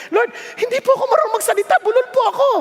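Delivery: high, hurried mock plea; pleading excuse. Preacher telling God he stutters, pleading. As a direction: whine your excuse, pitch up. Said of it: "ganun ang tanong ko eh".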